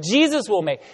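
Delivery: Jesus stressed with a falling tune. Shown as a demonstration. Pastor shows the congregation how to stress a verse, Jesus stressed. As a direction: land hard on Jesus. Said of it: "we emphasize a word".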